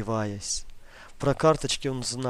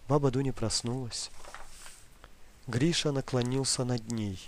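usual speaking voice

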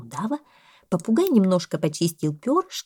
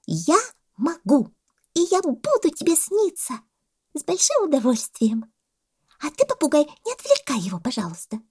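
high, emphatic monkey voice; solemnly announcing monkey; 10 semitones higher than usual. A narrator's reading. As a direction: declare it grandly in a high voice. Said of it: "торжественно объявила мартышка". (мартышка, торжественно, тонким голоском)

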